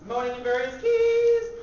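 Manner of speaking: high, drawn-out, sung-like mock moan. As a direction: stretch the words into moans at changing pitches. Demonstrates moaning in various keys.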